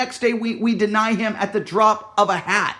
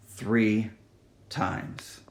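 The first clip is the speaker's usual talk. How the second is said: hushed emphasis; 11 semitones lower, 7 dB quieter than usual. Acted. Dramatic hushed count.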